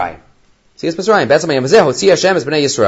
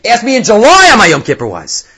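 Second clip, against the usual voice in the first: louder, higher burst on July; emphatic retort; 5 semitones higher, 5 dB louder than usual. Retold or imitated. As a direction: punch out the rabbi's answer. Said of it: "He says".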